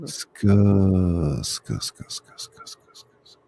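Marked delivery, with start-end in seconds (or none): 1.4-3.4 unvoiced ska-ska clicks fading away; soft fading ska mouth sounds; whispered ska sounds. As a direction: fade out the ska trigger noises. Mock ASMR mouth sounds.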